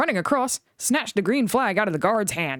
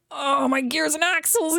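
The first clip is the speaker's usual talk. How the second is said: groaning; 9 semitones higher than usual. A narrator's reading. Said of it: "he groaned".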